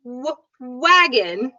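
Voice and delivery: W-, wagon with lips pushed out for a kiss; rounded W. Shown as a demonstration. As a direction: kiss into the W, slowly. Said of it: "just take your, your lips".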